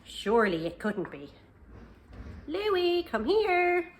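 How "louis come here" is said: high loud call; owner's high, loud call to the dog. Acted. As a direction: call the dog from another room.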